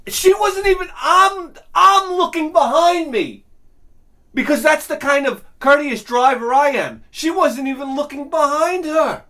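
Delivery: high-pitched outraged rant; indignant rant. Acted. Comic ranting about a driver who won't look behind, indignant.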